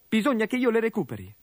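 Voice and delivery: high, fast resolute line; boy blurting his decision; determined young orc. Acted.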